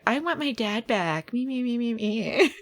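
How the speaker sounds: lightly voiced whiny daughter parody; a hint of a pleading daughter, mocking; mock Abigail. Host imagines a daughter wanting her dad back, lightly mocking plea. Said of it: "maybe Abigail is like".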